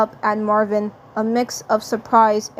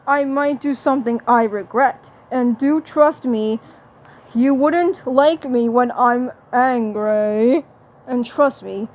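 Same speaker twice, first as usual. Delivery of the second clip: Marvin the Martian. Acted. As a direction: savour the polite cartoon threat. Voices Marvin threatening playfully.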